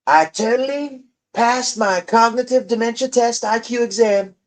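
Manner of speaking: high, lightly done Trump boast; Trump impression. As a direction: brag like a weak Trump imitation. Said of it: "I just need the voice".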